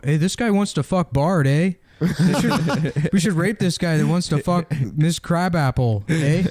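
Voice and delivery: a hint of polite Canadian inmate; Canadian accent. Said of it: "And then in Canadian prison, it's like".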